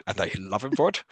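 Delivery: a hint of the sheriff's cold disdain on "love"; Alan Rickman impression. Quoting the sheriff to his co-host, a light Rickman impression. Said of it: "Who are you doing an impression of there?".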